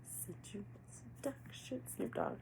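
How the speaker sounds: breathy sultry whisper. Pretend. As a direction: whisper it seductively. Repeating the song title breathily.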